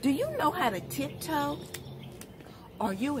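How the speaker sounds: put-on reading to children. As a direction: read it like story time on the rug. Reads picture book to children.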